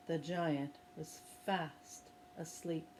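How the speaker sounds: storyteller telling of the sleeping giant, hushed; soft narration. A narrator's reading. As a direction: drop to a hush as he sleeps. Harp lullaby, giant sleeps.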